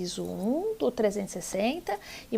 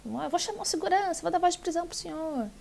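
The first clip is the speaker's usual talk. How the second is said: imitating the judge's threat; 7 semitones higher, much faster than usual. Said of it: "E ela falou".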